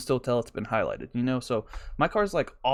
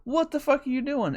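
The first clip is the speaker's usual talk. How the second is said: mock outraged yell; 9 semitones higher than usual. Yelling at driver with Instagram sticker.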